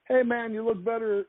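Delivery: put-on high-pitched stab at a Boston accent; attempted Boston accent. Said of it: "I can't do the Boston accent".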